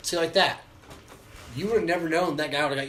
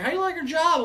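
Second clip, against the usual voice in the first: put-on high-pitched, louder old folks' question; old people asking; 14 semitones higher, 6 dB louder than usual. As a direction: imitate nosy old customers, pitched way up. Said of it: "Old people were like".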